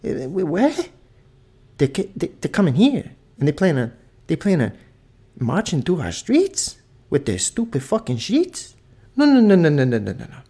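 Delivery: Italian grandpa outraged. Retold or imitated. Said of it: "He said".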